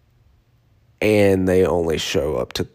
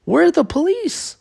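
very high, slow disbelieving shout; high comic panic; mock outrage. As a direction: squeal it in shock. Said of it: "And I'm like".

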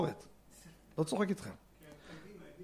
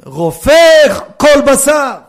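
loud, ringing declaration that climbs high; emphatic proclamation; 8 semitones higher, 13 dB louder, slower than usual. Real, not acted. Preacher quoting a blessing to listeners, loud proclamation. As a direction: proclaim it at full voice.

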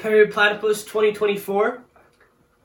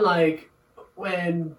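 ordinary delivery

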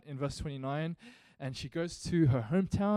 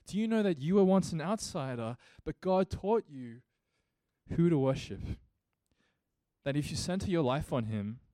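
loud, high, impassioned preaching; full-force preaching; 3 semitones higher, 5 dB louder than usual. Acted. Pastor urging the church to remember grace, in loud impassioned preaching. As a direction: preach it, lift the congregation. Repeated rousing appeal.

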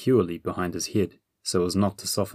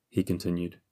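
ordinary delivery

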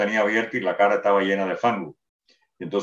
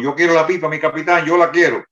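higher and louder, pushy grab for the pipe; eager demand; 7 semitones higher, 5 dB louder than usual. Retold or imitated. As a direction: blurt it out, insisting, pitch raised high. Sergeant bursts in demanding pipe.

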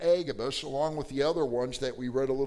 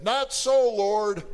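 loud, high protest to the master's face; defiant outburst; 6 semitones higher, 8 dB louder, much slower than usual. Acted. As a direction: shout back at Jesus in defiance. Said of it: "Peter got in Jesus' face and said".

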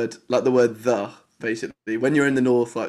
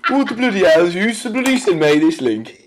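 put-on Birmingham accent; 3 semitones higher than usual. Random man from Digbeth joins the call, baffled Brummie grumbling. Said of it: "like, from Digbeth".

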